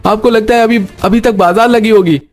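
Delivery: high-pitched irritated protest; annoyed. Acted. Rain-soaked son to mother who wanted vegetables, annoyed. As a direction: protest crossly, pitched well up. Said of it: "एक दूसरे पर नाराज मत होइए".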